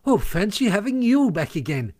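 high-pitched, delighted welcome; beaming delight. A narrator's reading. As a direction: marvel happily at a friend's return. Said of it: "cried the Mole, his face beaming".

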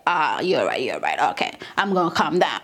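a big voice calming itself down; mock God relenting. God conceding to Moses, low and hushed.

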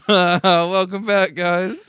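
slow, loud, laughing welcome back; drawn-out welcome. Acted. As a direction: stretch it out like a host.

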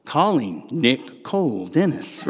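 slow robotic readback, each word set apart; computer voice. Retold or imitated. Truck computer confirms the call, imitated slow computer voice. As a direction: imitate an automated phone system reading syllable by syllable. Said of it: "She goes".

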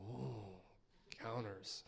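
hushed, low mocking "ooh"; softly poking fun, as if spooked; mock awe. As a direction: drop to a soft, low tone of pretend wonder. Mocking talk of encounters.

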